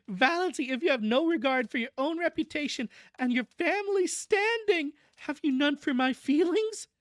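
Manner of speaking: high, wailing motherly reproach; wounded reproach. A narrator's reading. Mother recounting reproach to Valancy, high wounded wail. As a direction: plead with her in a high, wronged voice.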